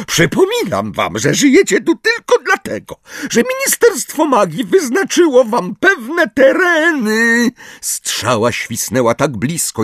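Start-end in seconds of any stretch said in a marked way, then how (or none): 0.1-7.5 very high, indignant squeal; Umbridge. A narrator's reading. Umbridge reminds centaurs who rules, indignant. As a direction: lecture them in squeaky outrage. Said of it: "ale i z oburzenia".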